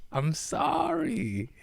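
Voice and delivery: a drawn-out, high, silly apology; squeaky mock apology.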